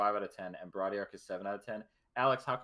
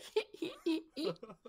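devolving laugh. Retold or imitated. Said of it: "you just devolve into, like, a".

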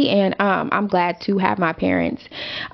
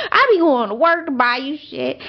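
ordinary delivery

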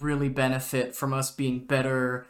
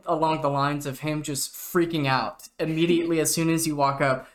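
ordinary talk

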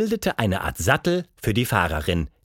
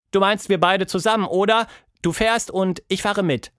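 Tilda's voice; 7 semitones higher than usual. Acted. Tilda asking the monster, higher eager voice. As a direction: ask eagerly.

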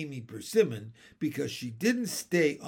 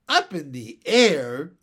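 loud, indignant echo; high and angry; 10 dB louder than usual. A narrator's reading. Mary Poppins shocked at Michael's claim, high and angry. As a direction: throw the question back in outrage. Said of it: "Mary Poppins' voice was high and angry".

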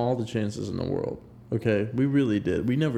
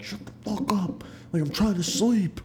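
high-pitched, held-back imitation of yelling; mock scream; 7 semitones higher than usual. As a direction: imitate a roommate's scream without full volume. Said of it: "just screaming back at him".

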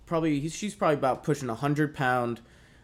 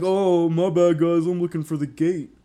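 raised, loud excuse-making; acted-out hasty apology; apologetic intruder; 6 semitones higher, 8 dB louder than usual. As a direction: play a caught man talking his way out. Acts out cornered intruder's excuse.

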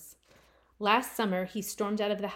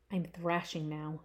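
usual speaking voice

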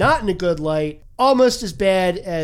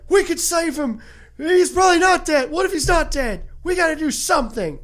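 urgent dad; 10 semitones higher than usual. Retold or imitated. Dad urging rescue.

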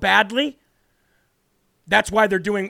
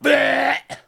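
higher, short retching noise of disgust; disgusted blech; 4 semitones higher than usual. Real, not acted. Disgust after his own mocking impression.

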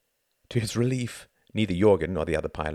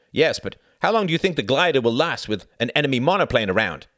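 raised, loud challenge; demanding; 4 semitones higher, 5 dB louder than usual. A narrator's reading. Pilot challenging the glider lover, demanding. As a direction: press the question hard, pitched up. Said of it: "demanded Jorgen".

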